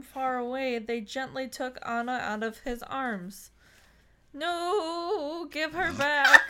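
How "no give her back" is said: desperate plea. Acted. Gerard cries for Anna.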